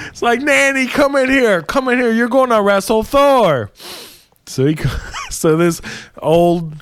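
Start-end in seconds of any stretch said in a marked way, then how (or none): 0.4-3.7 high, singing-out summons; calling out. Retold or imitated. King summoning his grandma to wrestle Thor, high mock call. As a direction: call her in from another room, pitched high.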